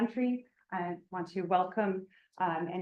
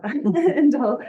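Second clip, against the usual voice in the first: a burst of laughter through the word, louder and higher; laughing; 6 semitones higher, 11 dB louder than usual. Real, not acted. Introducing the guest speaker to the seminar audience, laughing. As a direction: laugh it out, amused at the coincidence.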